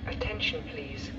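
ordinary talk, nothing marked

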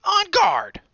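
very high, loud challenge; high loud fierce challenge; battle cry. A narrator's reading. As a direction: shout it like a tiny knight. Dot challenges.

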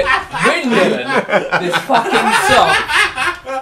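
words cracking into real laughter; laughing. Real, not acted. Telling friends the dog story, laughing out loud. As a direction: laugh through it as the story peaks.

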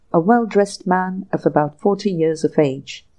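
usual speaking voice

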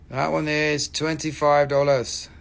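normal talking voice

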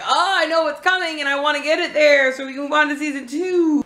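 high, eager self-quote; mock impatience. Viewer wanting to reach season two, high mock impatience. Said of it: "where I'm like".

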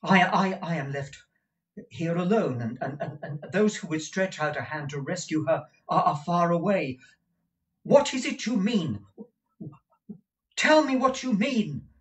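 high, urgent, stumbling plea; distraught young man. Acted. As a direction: beg for the truth, words tripping over each other. Nicholas distraught.

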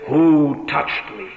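slow, measured question at normal pitch; deliberate answer to astonished disciples; insistent reply. Retold or imitated. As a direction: hold to the question, word by word. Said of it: "Oh, yes, he says, I am saying".

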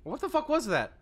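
high startled what the; shocked. Real, not acted. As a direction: blurt it out in shock. Shocked at loud TTS.

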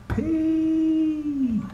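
plain reading voice

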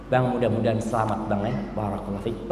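low, soft wifely blessing; see a husband off gently; hushed, loving send-off; tender farewell. Acted. Wife sends husband off at door.

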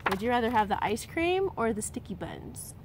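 ordinary delivery

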